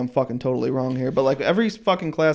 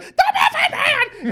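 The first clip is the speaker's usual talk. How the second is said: much higher, slow, laughing scream of The Muffin Man; screaming gingerbread man; slower than usual. Retold or imitated. Quoting Shrek's gingerbread man to friends, a slow high laughing scream. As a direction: shriek it out like a frantic cookie. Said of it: "And he fucking screams it".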